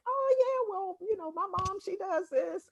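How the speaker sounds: high-pitched quote of a typical commenter; imitating readers' responses. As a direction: put on a small, high voice for them. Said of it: "those were the type of responses that I got".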